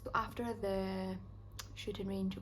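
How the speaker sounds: normal talking voice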